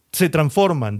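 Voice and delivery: normal talking voice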